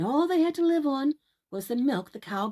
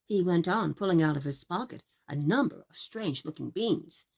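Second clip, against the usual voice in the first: usual speaking voice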